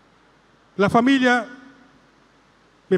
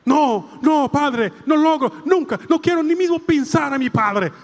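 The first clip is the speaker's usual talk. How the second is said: higher, urgent pleading no; a girl begging not to; pleading refusal; 6 semitones higher than usual. Retold or imitated. Girl begs the priest, refusing in rising protest.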